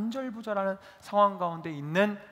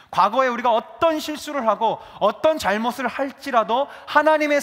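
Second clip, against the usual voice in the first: louder sermon voice building conviction; impassioned pulpit delivery; fervent preaching; 5 semitones higher, 8 dB louder than usual. Acted. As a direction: preach it to the congregation with fervour.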